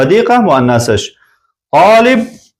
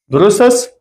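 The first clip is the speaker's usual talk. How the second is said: ordinary talk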